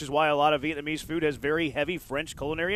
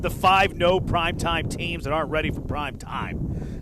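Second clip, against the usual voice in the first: normal talking voice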